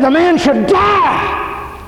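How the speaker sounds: a king bellowing a death sentence; slow outraged shout of judgment; angry king. Acted. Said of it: "He was angry. He was mad".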